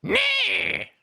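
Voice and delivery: piercing, very high mock screech; angry old German woman. Shown as a demonstration. Old woman yelling at a jaywalker, mock angry screech. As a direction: screech it like a scolding German granny. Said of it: "the angry old woman".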